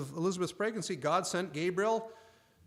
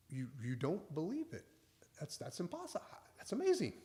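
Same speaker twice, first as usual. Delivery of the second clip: puzzled amazement; 8 dB quieter than usual. Retold or imitated. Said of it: "Gabriel is more just puzzled".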